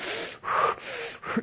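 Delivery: hard, puffing exhale; mimed laboured breaths of a struggling runner; runner's heavy breathing. Acted. Preacher acts out runners trying to breathe, puffing hard.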